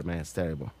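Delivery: ordinary talk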